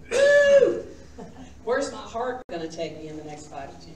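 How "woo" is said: high, loud whoop of delight; loud preacher whoop; excited whoop. Real, not acted. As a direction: let out a joyful cry.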